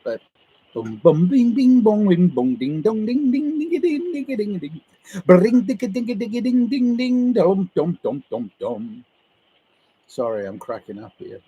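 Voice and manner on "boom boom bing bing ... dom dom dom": playful run of bings, dings and doms; imitated marimba run. Shown as a demonstration. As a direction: chime out a marimba melody by mouth.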